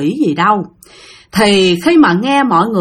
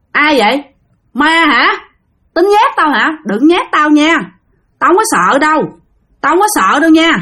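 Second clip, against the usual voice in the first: scared bluster; 6 semitones higher than usual. Retold or imitated. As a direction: yell at the ghost to hide the fear. Scared uncle yells at ghost.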